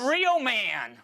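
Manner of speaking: hammed-up emphasis. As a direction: play it up for a laugh. Mock psychic reveal.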